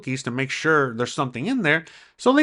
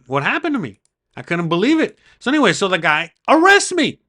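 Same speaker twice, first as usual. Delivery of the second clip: ordinary delivery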